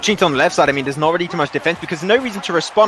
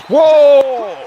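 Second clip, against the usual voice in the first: surprised whoa; 9 semitones higher, 9 dB louder than usual. Real, not acted. Sudden play surprises commentator.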